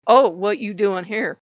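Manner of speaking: slow, loud mock disapproval; judgmental. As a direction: drawl it out loudly, judging someone. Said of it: "with this judgmental look like".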